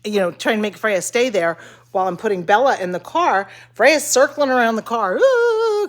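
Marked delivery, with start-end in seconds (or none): 5.2-5.9 very high, squealy dog excitement; excited dog voice. Pretend.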